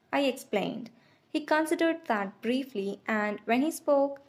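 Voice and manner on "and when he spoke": ordinary delivery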